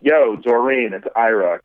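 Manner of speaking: ordinary delivery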